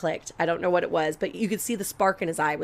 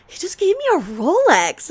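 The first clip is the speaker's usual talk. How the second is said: higher, louder teasing boast; gloating brag; 11 semitones higher, 7 dB louder than usual. Retold or imitated. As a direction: show off to make her jealous. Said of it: "I was like".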